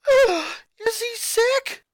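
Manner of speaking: very high, loud, slow worried question; shocked high alarm. A narrator's reading. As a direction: panic about his health. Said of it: "before letting out a gasp".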